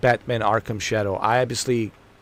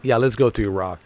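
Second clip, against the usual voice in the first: ordinary delivery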